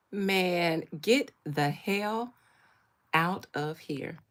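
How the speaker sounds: slow, drawn-out disgust; slow disgusted dismissal. Real, not acted. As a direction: drag out the disgust. Reacting disgusted to meme.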